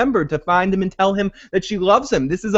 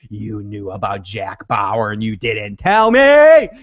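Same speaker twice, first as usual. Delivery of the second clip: low slow accusing mock fury; imitating angry Audrey; 7 semitones lower, 4 dB louder, slower than usual. Audrey confronting the president over Jack, slow low mock anger. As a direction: drag out the betrayal, lower and slow. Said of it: "Audrey is like".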